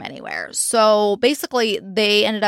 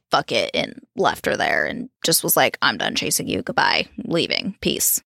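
lower, mock careless goodbye; imitating a man walking away, bored; dismissive brush-off; 9 semitones lower than usual. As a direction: shrug her off, lower and done with it. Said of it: "Michael basically said, like".

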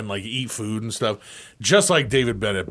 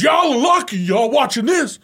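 high, loud scolding coach; mock coach scolding; 12 semitones higher, 6 dB louder than usual. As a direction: scold the team. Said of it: "He goes".

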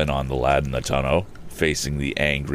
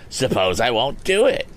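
higher, defiant retort; snapped; 8 semitones higher than usual. A narrator's reading. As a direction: snap back defiantly. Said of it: "snapped Andy".

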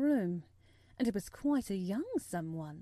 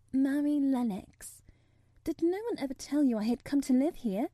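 put-on high, louder girl's voice; forthright young girl; 4 semitones higher, 6 dB louder than usual. A narrator's reading. Girl giving boy her name, forthright. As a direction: forthright girl questioning, pitched up.

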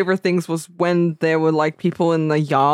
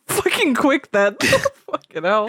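laughing; 7 semitones higher than usual. Real, not acted. Laughs while quoting.

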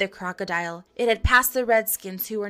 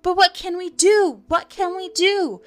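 high, anxious cry; helpless alarm; 10 semitones higher, 4 dB louder than usual. A narrator's reading. Frightened boys face the wolves, crying out helplessly. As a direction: wail the question, pitched well up.

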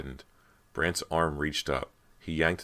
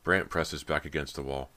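ordinary delivery

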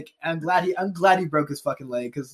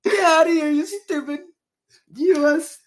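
high-pitched put-on voice; mock indignant eviction; 11 semitones higher than usual. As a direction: send them packing in a silly high voice. Mock eviction of federal government.

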